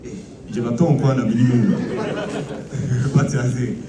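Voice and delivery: fast, garbled mock French, bluffing; hurried mock French from a student caught out; flustered fake French. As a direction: rattle off nonsense French like a confused learner. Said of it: "then you're like".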